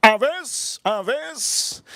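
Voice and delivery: high-pitched imitation of a father; put-on high dad voice; friend's father. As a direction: chant it high, like an odd dad. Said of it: "And then he goes".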